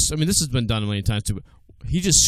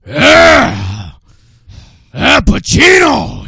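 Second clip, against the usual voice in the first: much higher, much louder, stretched out yell; Pacino shout; 12 semitones higher, 12 dB louder, much slower than usual. Acted. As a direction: bellow like late-career Pacino. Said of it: "That's my late, later life Pacino".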